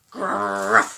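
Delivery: deep throaty dog growl; low growl. Shown as a demonstration. Mom demonstrating a guard dog for the girls, low growl. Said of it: "Can you growl?".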